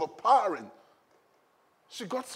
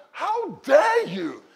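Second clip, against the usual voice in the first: slow, high, loud righteous outrage; furious preacher's roar, drawn out; angry; 11 semitones higher, 6 dB louder, much slower than usual. Acted. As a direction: thunder it slowly like an indignant challenger. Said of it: "He was angry in his spirit".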